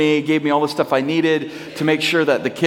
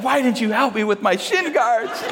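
higher-pitched comeback building to a punchline; indignant daughter; 6 semitones higher than usual. Retold or imitated. Daughter retorting to dad, imitated indignation. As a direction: imitate a child throwing the question back.

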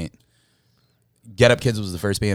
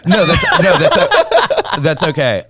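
bursting out in loud laughter; laughing; 10 semitones higher, 8 dB louder, slower than usual. Real, not acted. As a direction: laugh through the words, high and loud. Nervous apology answered with laughter.

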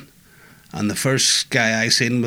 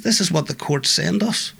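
retelling an officer's exasperation in a higher voice; exasperated officer; 7 semitones higher than usual. Retold or imitated. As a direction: let the officer's frustration push the pitch up. Frustrated officer quoted.

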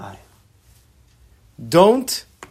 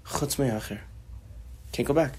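inner voice heard; 10 dB quieter than usual. Retold or imitated. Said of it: "Acher heard in his ears".

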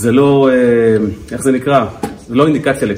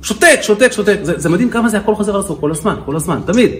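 imitated wife's heated, higher-pitched rant; heated complaint; 7 semitones higher than usual. Wife complains about her silent husband to counselor, heated. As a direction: build a mock exasperated tirade, pitch jumping up. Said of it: "היא אמרה את זה בכזה להט".

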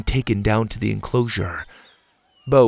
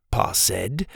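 plain reading voice

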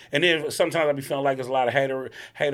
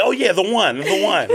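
teasing imitation; 8 semitones higher, 8 dB louder than usual. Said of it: "you just threw it out there".

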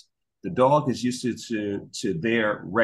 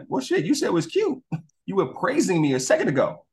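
high indignant dog voice; 8 semitones higher than usual. Retold or imitated. Dog answering the parents.

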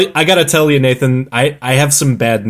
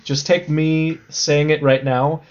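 ordinary delivery

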